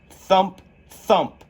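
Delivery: loud, high thumps of landing. A narrator's reading. Narrator voicing Alice's landing, punchy. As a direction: land each thump hard.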